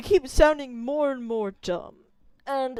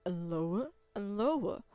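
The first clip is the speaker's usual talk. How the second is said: stepping lower and lower; 2 semitones lower than usual. Shown as a demonstration. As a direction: drop the voice further on each "lower". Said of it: "I can get lower".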